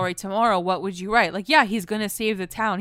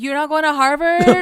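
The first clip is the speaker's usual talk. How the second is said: higher, louder shocked question; mock disbelief, voice raised; freaking out; 5 semitones higher, 5 dB louder than usual. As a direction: imitate a dad aghast at the news. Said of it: "like, freaking out".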